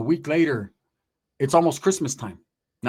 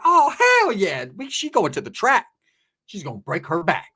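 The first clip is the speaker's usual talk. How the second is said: imitating eager brother; 5 semitones higher than usual. Storyteller mimics any brother reacting to the offer, hammed-up excitement. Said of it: "You ask any brother, he'd be like".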